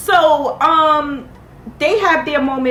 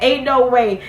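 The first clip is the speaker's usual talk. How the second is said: ordinary delivery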